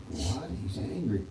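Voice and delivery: quietly taken aback; father hurt and baffled at the news; hushed disbelief. Acted.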